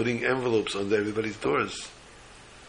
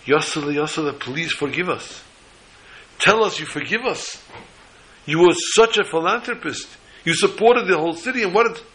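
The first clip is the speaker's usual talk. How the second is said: pleading cry; 7 semitones higher, 5 dB louder than usual. Retold or imitated. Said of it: "he started to cry".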